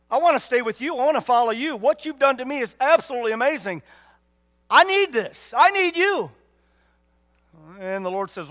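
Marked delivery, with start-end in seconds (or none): 0.1-6.3 loud, high, impassioned begging, building to a shout; fervent plea. Acted. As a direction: cry out like a man overwhelmed with gratitude. Preacher acts healed man's plea.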